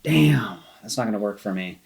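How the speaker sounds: ordinary delivery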